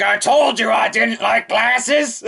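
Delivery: high, slow peeved put-on voice; Wo class voice. Wo class scolding Musashi about glasses, high and slow. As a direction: sulk it. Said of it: "Wo class is like".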